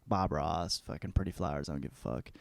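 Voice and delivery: lightly voiced mellow artist; laid-back painter. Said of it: "He's like".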